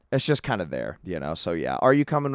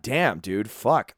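ordinary delivery

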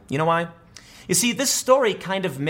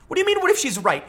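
much higher, disbelieving repeat; incredulous; 13 semitones higher, faster than usual. Real, not acted. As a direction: pitch it up in disbelief.

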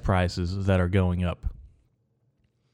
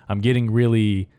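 mock irritation; 3 semitones higher, 3 dB louder than usual. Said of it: "really irritated".

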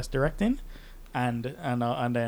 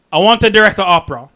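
outrageous demand; 6 semitones higher, 13 dB louder, faster than usual. Retold or imitated. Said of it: "He's like".

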